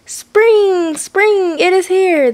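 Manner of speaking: high, loud, excited shouting. A narrator's reading. Groundhog shouting into the grumpy pig's ear, loud excited shout. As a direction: blast it right into his ear. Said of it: "the groundhog shouted loudly in the pig's ear".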